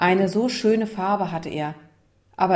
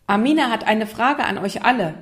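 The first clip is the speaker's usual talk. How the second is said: louder, higher classroom announcement; calling out; 4 semitones higher, 5 dB louder than usual. Acted. Teacher introduces Amina's question to everyone, calling out. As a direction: announce it to the whole room. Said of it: "rief Frau Bredow".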